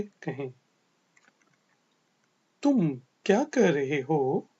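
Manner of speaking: ordinary talk, nothing marked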